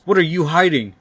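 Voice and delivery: high, loud accusing yell; angry yell. Acted. Doc Ock beating down on Norman, angry yell. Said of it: "gets incredibly angry and yells".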